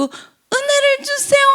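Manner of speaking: high, drawn-out prayerful plea; mock pleading. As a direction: beg for grace in a hammed-up high wail. Acts someone begging for grace. (높고 길게 끌며 애원하는 척)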